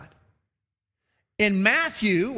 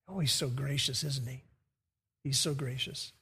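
hushed awe; 7 semitones lower, 8 dB quieter than usual. Real, not acted. Awed aside about God's grace.

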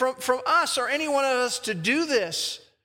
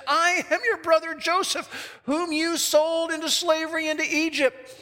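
high, emphatic announcement; dramatic declaration; 6 semitones higher than usual. Acted. Joseph tells his brothers who he is, emphatic high declaration. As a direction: declare it with the pitch held up.

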